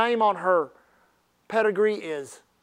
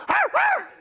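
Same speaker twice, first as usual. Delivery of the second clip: high, sharp mock yelps; dog yelp. The preacher imitating Honey yelping when whacked harder. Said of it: "she'd go".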